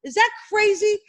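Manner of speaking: usual speaking voice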